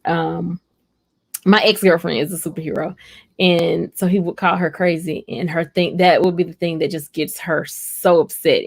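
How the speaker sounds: ordinary delivery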